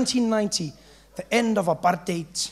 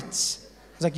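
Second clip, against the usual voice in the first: ordinary talk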